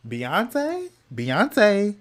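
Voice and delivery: rising disbelief, drawn up high; quoted meme line. Retold or imitated. Vlogger quotes a meme in mocking disbelief, high rising. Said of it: "if you girls know where that is from".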